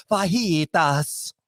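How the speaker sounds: "fajitas" stretched out as fa-HEE-tas; dad's showy Spanish. Retold or imitated. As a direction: overdo the Spanish middle of "fajitas". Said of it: "when your dad goes to the Mexican restaurant".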